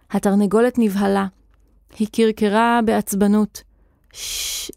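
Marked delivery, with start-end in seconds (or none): 4.1-4.7 soft voiceless shushing; pleading hush. A narrator's reading. Nochash begging the shouting wizard to be quiet, pleading hush. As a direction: hush them softly and urgently. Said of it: "התחנן נוחש".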